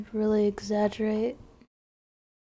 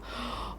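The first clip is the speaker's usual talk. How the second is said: sharp gasp; 15 dB quieter than usual. Real, not acted. Sudden scare while drawing.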